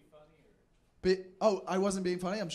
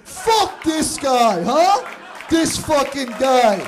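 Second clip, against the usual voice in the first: mock angry shout. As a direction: bellow it in fake outrage. Mock outrage at heckler.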